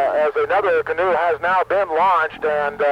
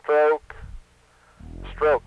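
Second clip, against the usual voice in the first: slow, even calls of each stroke with long gaps; steady, spaced-out stroke calls like a canoe coach; paddle stroke rhythm; much slower than usual. Shown as a demonstration. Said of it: "I'll give you a, uh, kind of a feel for the stroke".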